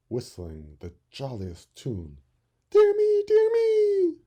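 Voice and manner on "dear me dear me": very high, loud cry of alarm; alarmed birds. Acted. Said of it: "cried the birds".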